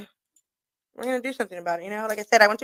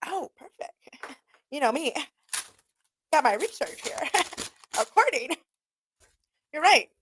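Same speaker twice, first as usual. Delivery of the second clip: higher-pitched retort broken by laughs; amused mock reply; 4 semitones higher than usual. Retelling a comeback to the guy from L A, laughing mockingly. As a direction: laugh between the words, playing up the comeback.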